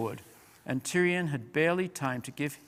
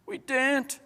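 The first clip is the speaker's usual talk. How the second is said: much higher frightened small voices; whimpering; 12 semitones higher than usual. A narrator's reading. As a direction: whimper it in fear. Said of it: "whimpered dozens of voices".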